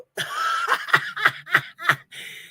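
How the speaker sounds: squealing high-pitched laugh; helpless high laughter. Real, not acted. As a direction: let out a shrieking giggle. Laughing at chat.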